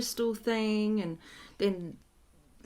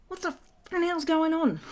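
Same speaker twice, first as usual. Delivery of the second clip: higher-pitched retold outburst; angry dad; 9 semitones higher, 4 dB louder than usual. Confused dad confronting the healer, mock angry. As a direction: play the confused, cranky dad demanding answers. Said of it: "He's really angry".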